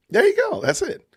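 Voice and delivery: high squeaky "There you go". Pretend. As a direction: pop it up high like a cheer. Same words said twice.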